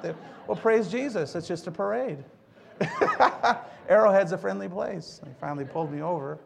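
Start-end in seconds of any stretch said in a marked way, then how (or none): 2.8-3.8 a bright high burst of laughter; high-pitched real laugh. Real, not acted. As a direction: laugh out loud at your own joke. Laughs at own parade joke.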